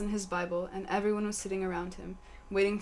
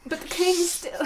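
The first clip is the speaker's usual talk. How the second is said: lady voice; 10 semitones higher, 7 dB louder than usual. Acted. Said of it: "said Sally Minefield".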